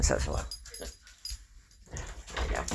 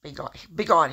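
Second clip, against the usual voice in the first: loud dismissal; 4 semitones higher, 7 dB louder than usual. Pretend. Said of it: "God's not gonna say".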